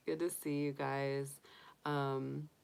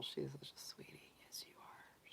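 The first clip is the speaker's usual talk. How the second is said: whispered, much softer, cooing to the pet; 18 dB quieter than usual. Real, not acted. Owner whispering sweetly to her cuddling bunny, hushed. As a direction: breathe it to the bunny like a secret.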